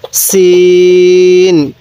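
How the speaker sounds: সিন held very long, thin and firm s; thin hard sin. Shown as a demonstration. Teacher models Arabic sin, thin and hard, very slow. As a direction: draw out সিন with a thin sharp s. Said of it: "সিনটাকে খালি বলবো".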